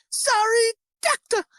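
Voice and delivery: very high, slow mock-frightened apologies; horrified woman. Imitating the woman apologising to the doctor, mock horrified. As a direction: squeak out each word like a cowering victim. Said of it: "She's just fucking horrified".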